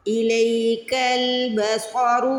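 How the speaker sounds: بصر with a thick ص and ر, slow; heavy sad and ra. Shown as a demonstration. Teacher modelling heavy letters for students, slow chant. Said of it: "صاد اور را کو پھر موٹا پڑھنا ہے".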